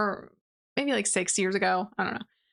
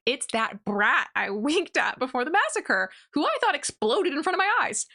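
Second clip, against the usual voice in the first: acted disbelief, climbing much higher; shocked realization; 5 semitones higher than usual. The Supreme recognizes the brat he winked at, incredulous. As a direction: imitate a ruler's rising, incredulous outburst.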